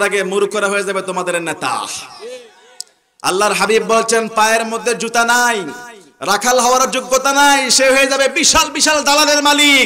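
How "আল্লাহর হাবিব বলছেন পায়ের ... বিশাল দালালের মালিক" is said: rising sermon proclamation; fiery preaching. Real, not acted. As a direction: build higher and louder to the last phrase. Preaches at full force.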